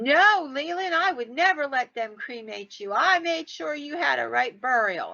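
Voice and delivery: put-on upset old lady. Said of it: "getting really upset".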